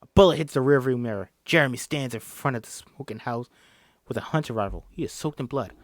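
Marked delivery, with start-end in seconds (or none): none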